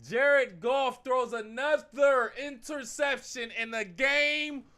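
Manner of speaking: loud, slow, high angry call; angry rant. Real, not acted. Fan raging that his team needs a win, loud and angry. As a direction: drag it out in disbelief and anger.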